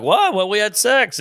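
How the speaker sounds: high-pitched startled protest; mock shocked, voice jumping up; indignant disbelief. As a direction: imitate a baffled hookup protesting.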